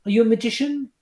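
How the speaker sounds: high-pitched impression of a puzzled judge; pitched well up, imitating someone unsure; Amanda. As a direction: put on a high, wondering voice. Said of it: "that's when Amanda kind of goes".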